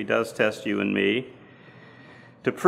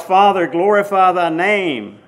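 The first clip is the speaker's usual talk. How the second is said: raised, higher anguished cry to the Father; 6 semitones higher, 7 dB louder than usual. A narrator's reading. Jesus in anguish cries out to his Father, loud and pleading. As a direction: call it out louder, pleading. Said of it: "he cried out".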